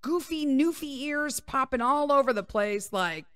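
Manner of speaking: usual speaking voice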